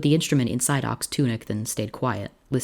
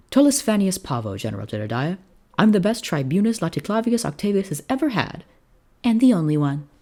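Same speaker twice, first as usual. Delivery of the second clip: cheeky officer voice; 8 semitones higher, 4 dB louder than usual. A narrator's reading. Cheeky young officer introduced.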